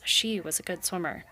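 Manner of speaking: ordinary delivery, nothing marked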